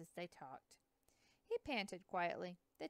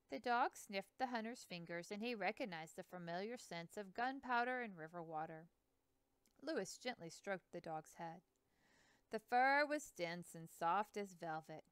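ordinary delivery, nothing marked

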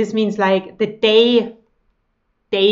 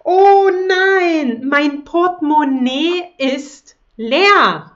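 mock dismay; 5 semitones higher, 3 dB louder than usual. Example sentence, acted dismay.

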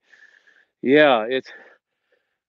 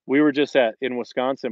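normal talking voice

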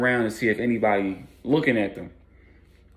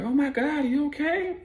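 much higher, worried woman's voice; alarmed waitress. Retold or imitated. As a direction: gasp it like a startled waitress. Waitress shocked by spilled food.

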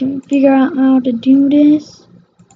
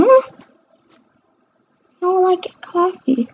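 high squeaky voice; 8 semitones higher than usual. Pretend. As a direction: pipe it up high like a toy. Pitched-up pretend voice.

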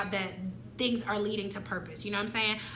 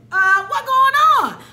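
much higher and louder, slow alarmed father; alarmed dad; 14 semitones higher, 13 dB louder, much slower than usual. Retold or imitated. Dad finding his sobbing daughter in the car, alarmed. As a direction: cry out like a startled father.

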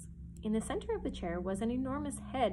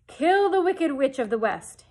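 loud, higher wizard decree; booming command; 5 semitones higher, 13 dB louder than usual. Acted. Oz orders Dorothy to kill the witch, booming. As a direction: thunder the command out. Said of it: "answered Oz".

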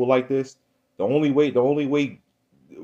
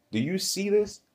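pitched-up exclamation; 10 semitones higher, 3 dB quieter than usual. Acted. Preacher rising to a peak.

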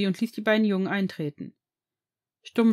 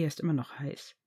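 much softer, sheepish admission; sheepish grin; 5 semitones lower, 7 dB quieter than usual. Acted. Harry conceding to his boyfriend, softly grinning. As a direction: admit it with a grin, quietly. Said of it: "sagte Harry grinsend".

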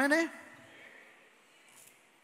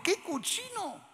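disgusted; 11 semitones higher, 5 dB quieter than usual. Shown as a demonstration. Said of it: "Di conmigo".

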